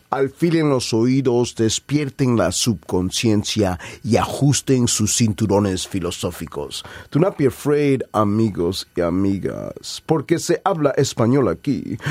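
dramatic bilingual poetry delivery; spoken word performance. Acted.

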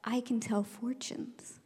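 slow, hushed confession; secretive whisper. Retold or imitated. Teacher fooling the class about a gift, slow secretive whisper.

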